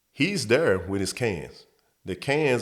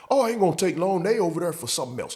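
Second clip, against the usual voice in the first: higher put-on voice of a buddy; lightly squeaky coworker reassuring; higher mock buddy, brushing it off; 9 semitones higher, faster than usual. Coworker explaining at aluminum place.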